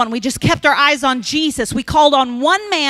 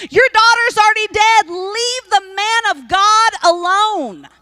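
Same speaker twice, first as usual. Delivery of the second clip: higher, slow, harsh delivery of bad news; messenger's blunt news; 8 semitones higher than usual. Messenger tells the father his girl died, harsh and high. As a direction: voice the messenger telling Jairus coldly. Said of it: "This man is saying".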